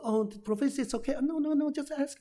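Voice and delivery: high, deferential student voice; student reassuring his professor, high and deferential; polite student. Retold or imitated. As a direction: brush it off politely like an anxious student. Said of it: "他说：".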